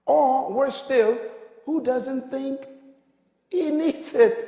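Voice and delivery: loud, high-pitched preacher's delivery; full-force preaching. Real, not acted. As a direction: drive it home to the congregation, loud and high.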